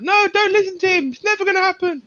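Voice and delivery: very high, sweet little angel; squeaky angel on the shoulder. Pretend. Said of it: "the little angel's like".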